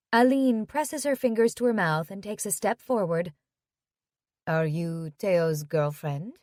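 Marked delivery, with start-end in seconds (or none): 4.4-6.4 put-on French accent. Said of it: "she has a French accent".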